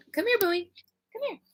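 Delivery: high, sweet call to the dog; sing-song come-here for a puppy; pet talk. Real, not acted. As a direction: coax the dog over in a high voice. Calling her dog over.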